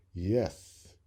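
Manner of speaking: plain reading voice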